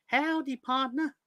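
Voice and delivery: high-pitched, exaggerated cowboy howdy. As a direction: put on a cartoonish high cowboy greeting. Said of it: "in the voice I heard it in".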